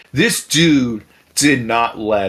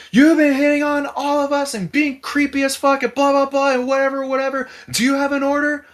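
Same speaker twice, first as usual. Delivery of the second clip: much higher mock scolding voice; imitated fed-up woman; 13 semitones higher, 3 dB louder than usual. Fed-up woman confronting the drunk creep, high mock scolding. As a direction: tell him off as the fed-up woman.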